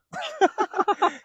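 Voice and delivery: high laugh bursting out; real amused laugh; laughing at his own story; burst of laughter. Real, not acted.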